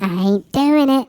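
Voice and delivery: high, silly refusal; filter lady impression. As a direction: refuse in a squeaky put-on voice. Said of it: "this sounds like that lady".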